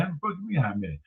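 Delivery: normal talking voice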